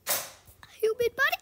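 loud, high horrified cry. Real, not acted. Player discovers a body in the horror game, horrified. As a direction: blurt it out in rising panic.

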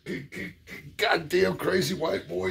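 high stammered mock old-man voice; stuttering uncle. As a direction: sputter it out half annoyed, half amused. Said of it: "Yeah, so he".

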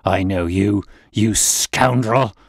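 loud, slow, spat-out insult; a raging old bully; angry accusation. Acted. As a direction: hurl the word scoundrel at him. Old man threatens Holmes.

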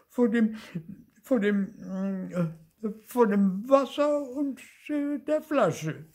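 very high, halting wail; frightened little ghost, faltering; fearful whimpering. A narrator's reading. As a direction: whimper and stumble over the words. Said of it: "jammerte das Gespenst".

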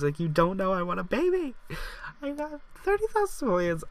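high-pitched excited squeal. Real, not acted. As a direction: squeal with delight, pitch shooting way up. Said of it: "I'm so excited".

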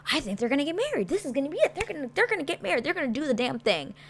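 high, fast and giddy; an eager fan sure the wedding is on; excited inner thought. Retold or imitated. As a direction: rattle it off in a high, excited rush. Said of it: "I'm like".

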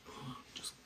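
hushed whispered aside; whispering. Real, not acted. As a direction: whisper it to yourself.

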